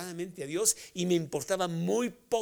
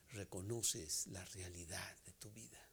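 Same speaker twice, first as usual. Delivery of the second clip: soft whispered question, lower; 8 semitones lower, 11 dB quieter than usual. Acted. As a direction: lean in and whisper it to them.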